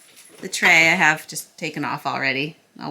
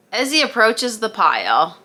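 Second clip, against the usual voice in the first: ordinary delivery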